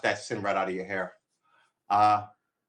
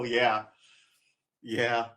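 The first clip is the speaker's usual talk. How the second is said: usual speaking voice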